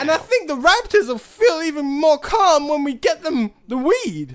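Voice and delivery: high, loud goofy stoner voice; doing a dopey surfer dude character. Acted.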